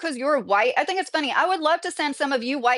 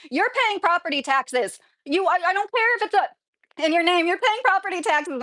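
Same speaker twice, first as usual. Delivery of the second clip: exasperated rant; 6 semitones higher than usual. Real, not acted. Heated rant about renting and owning.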